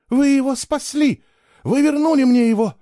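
high, loud tearful outburst; tearful babbling. Acted. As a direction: babble it through tears of relief. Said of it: "лепетала она и со слезами на глазах".

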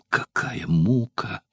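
slow, hushed groan of torment; anguished cry. A narrator's reading. Spartacus in torment, a slow anguished cry. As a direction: let the pain spill out slowly. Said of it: "вне себя вскричал Спартак".

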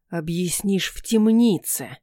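slow, cold menace; a prince squinting as he says it; cold narrowed threat. A narrator's reading. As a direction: prince threatens the man, coldly. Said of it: "прищурил глаза Алластер".

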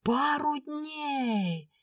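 drawn-out high roar, slow and stretched; roaring like a wounded dragon. A narrator's reading. The dragon husband outraged at his pregnant wife's trip, roaring. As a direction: stretch the words out like a wounded beast. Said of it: "взревел раненым драконом".

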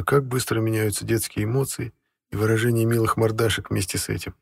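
plain reading voice

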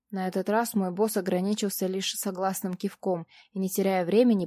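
usual speaking voice

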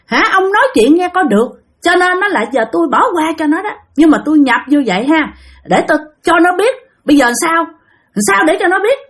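high-pitched, insistent spirit voice; possessed spirit. Retold or imitated. Spirit tells the man it will forgive her, high-pitched. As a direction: speak as a spirit granting a grudging pardon, high. Spirit agrees to spare the girl.

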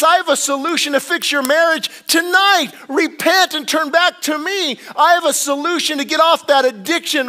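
high, loud preached voice of God; preaching God's call. Retold or imitated. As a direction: proclaim it as God calling sinners back. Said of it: "He says".

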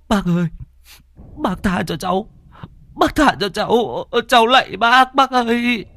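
put-on high, whining boy begging for mercy; whimpering plea. Thắng pleading with old Hỷ while being beaten, high whimpering. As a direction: beg like a sobbing child. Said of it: "Thằng Thắng mếu máo".

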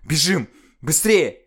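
громко и выше; подгоняй криком; торопливый крик. Acted. Арина торопит бежать. (громкий призыв бежать)

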